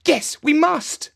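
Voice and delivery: put-on high, slow, earnest child; eager boy agreeing. A narrator's reading. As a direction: back your sister up. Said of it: "agreed Jeremy".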